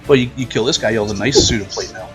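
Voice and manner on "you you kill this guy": ordinary talk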